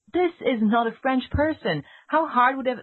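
indignant outburst, pitched high; exasperated. Retold or imitated. Exasperated reaction to movie French.

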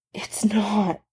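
breathy whisper, no voice at all. A narrator's reading. Steve whispering to Tony through tears. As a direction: barely breathe the words out. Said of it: "he whispered".

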